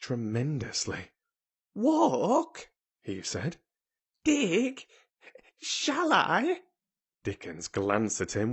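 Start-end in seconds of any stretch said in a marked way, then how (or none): 1.7-2.7 put-on high, astonished boy's voice; wondering question, pitched right up. A narrator's reading. Colin flushed at the idea of walking, astonished boy. 4.2-6.6 put-on high, wondering boy's questions; pitched right up in hopeful disbelief; astonished boy. A narrator's reading. Colin asking if he shall dig, astonished boy.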